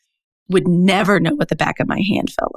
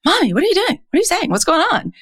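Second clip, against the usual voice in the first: put-on much higher child voice questioning mom; worried little daughter; 11 semitones higher, faster than usual. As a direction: pipe up like a startled little girl. Daughters call out mom's tone.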